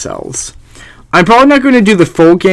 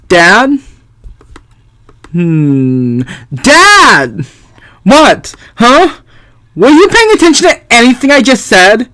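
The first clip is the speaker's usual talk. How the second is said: higher calls of Dad; exasperated girl; impatient daughter; 8 semitones higher, slower than usual. Acted. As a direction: call out to get his attention.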